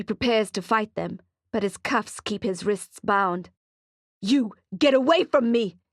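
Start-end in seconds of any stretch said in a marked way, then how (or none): 4.2-5.8 raised, defiant shout. Acted. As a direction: shout him off. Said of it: "he shouts at them".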